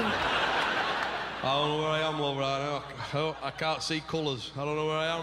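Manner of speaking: dazed numb mumbling. Retold or imitated.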